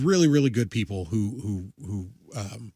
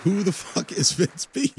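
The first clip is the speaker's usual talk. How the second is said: surprised; 7 semitones higher than usual. Real, not acted. Unknown winner announced.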